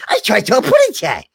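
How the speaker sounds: squeaky tiny bird lisp; Tweety voice. Retold or imitated. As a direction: chirp it like a baby canary. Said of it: "and he was".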